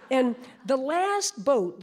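usual speaking voice

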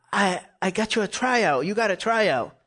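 high, eager imitation; excited news. Brother announcing a tryout to his brother, high and excited. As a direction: mimic someone bursting with good news.

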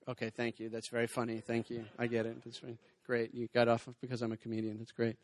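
low, soft, deflated thanks; wounded comedian muttering polite thanks; muted mock thanks. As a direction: take the insult quietly, pretending it's fine. Feigns thanks at the insult.

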